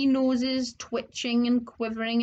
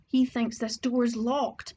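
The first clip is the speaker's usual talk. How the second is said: ordinary delivery, nothing marked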